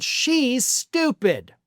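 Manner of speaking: very slow, drawn-out "She's stupid"; sarcastic replay of the press secretary's insult; slow mocking quote. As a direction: stretch the insult out slowly. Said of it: "and then said".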